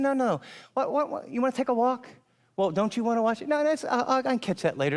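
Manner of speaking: tripping over himself to convince her; flustered insisting. Retold or imitated. Flustered husband insisting on walk.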